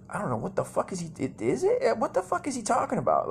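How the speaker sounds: high-pitched mock bewilderment; baffled kid reaction. Acts out his confused younger self.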